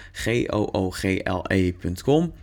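"G O O G L E" spoken as separate letters, then "punt com"; spelled out letter by letter. Shown as a demonstration. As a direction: spell "G O O G L E" one letter at a time. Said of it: "ik zal het spellen". ("G O O G L E" letter voor letter gespeld)

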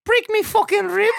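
high, shouted British taunt; mock boxer shout. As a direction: yell it like the cocky fighter. Mimicking the video taunt.

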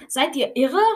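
incredulous, rising question; appalled outburst. A narrator's reading. Donald pulls the fighting boys apart, appalled. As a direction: exclaim it, pitch jumping up at the end.

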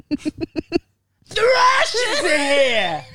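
high, very drawn-out scream; Italian Bronx woman. Acted. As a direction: blast it out as a hammed-up Bronx screech. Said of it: "I could do that voice".

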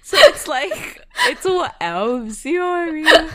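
laughing while talking; words broken by laughter. Real, not acted. Laughing through complaint.

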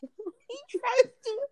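high, slow exasperated whine; exasperated wail. Real, not acted. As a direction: wail it out in despair. Exasperated about sneaky son.

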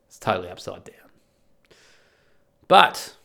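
ordinary delivery

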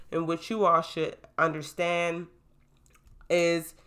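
ordinary talk, nothing marked

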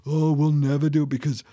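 hammed-up glum self-pity; Eeyore. Self-doubter feeling insignificant, mock Eeyore voice. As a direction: put on a woeful defeatist voice. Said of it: "the Eeyore spirit".

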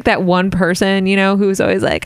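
normal talking voice

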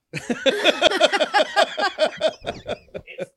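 high loud laugh; loud burst of laughter. Real, not acted. Podcast joke about wife calling.

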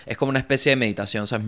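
usual speaking voice

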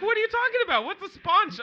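high, loud retold disbelief; retelling his confused reaction to a friend, loud and high; baffled outburst. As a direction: act out total confusion, shouting.

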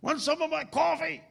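high voice of a rough street man. Retold or imitated. Homeless man offers the preacher his coffee, voiced high. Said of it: "this McDonald's styrofoam cup".

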